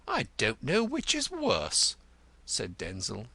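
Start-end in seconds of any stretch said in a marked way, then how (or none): 0.1-2.0 high, peevish complaint; irritated. Acted. Cantercot needled about drink and murder, irritated. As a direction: protest in a raised, fretful pitch.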